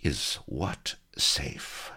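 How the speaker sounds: very slow, hushed, threatening question; silky menace. A narrator's reading. Pirate cutting in on the captives' secret, silky menace. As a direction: breathe it slowly with silky menace. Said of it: "a silky voice cut menacingly in".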